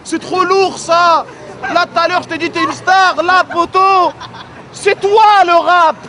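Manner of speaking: loud, very high-pitched shouted praise; excited hype shout. Real, not acted. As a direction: hype up the rapper at full volume. Hyping the rapper over crowd noise. (cris d'enthousiasme, voix très aiguë et forte)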